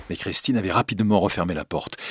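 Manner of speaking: ordinary delivery, nothing marked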